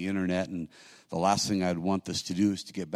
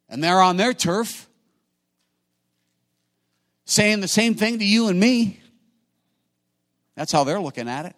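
much higher and louder, calling out to the congregation; preaching at full force; 11 semitones higher, 10 dB louder than usual. Acted. Pastor answers his own question, booming preaching cry. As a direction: ring it out from the pulpit.

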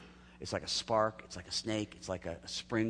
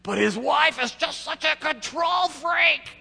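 much higher, louder, slow blurt; loud and clear; 11 dB louder, slower than usual. Retold or imitated. Preacher retells a line blaring out over the mic, high and loud. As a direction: blare it out slowly, high and loud. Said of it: "it's really loud and it's really clear".

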